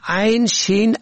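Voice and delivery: ordinary talk, nothing marked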